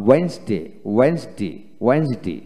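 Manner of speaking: ওয়েডনেসডে as two-beat "wens-day"; silent first d. Shown as a demonstration. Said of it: "এখানে ওয়েডনেসডে নয়". (প্রথম ডি ছাড়া ওয়েন্স-ডে)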